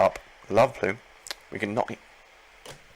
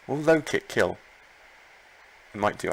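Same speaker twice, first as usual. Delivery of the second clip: usual speaking voice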